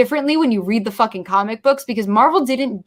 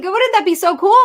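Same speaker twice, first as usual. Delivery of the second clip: high, loud, giddy question; mock starry-eyed wonder; excited younger self; 9 semitones higher, 4 dB louder than usual. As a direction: squeal it like a thrilled kid. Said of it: "I was like".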